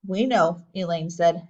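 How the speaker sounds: plain reading voice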